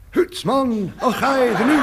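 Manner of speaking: put-on high, loud, mangled Irish accent that comes out Scottish; bad Irish accent. Stranger passing as Irish to a priest, badly faked Irish accent. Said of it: "I slipped into my best Irish accent".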